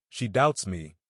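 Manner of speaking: usual speaking voice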